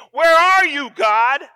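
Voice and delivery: high, loud plea to God; grieving cry. Retold or imitated. Preacher voicing his own cry of grief, high and loud. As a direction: call out in grief.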